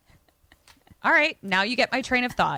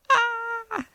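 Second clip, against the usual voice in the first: startled yelp; 14 semitones higher than usual. Retold or imitated. Scary moment in retold story.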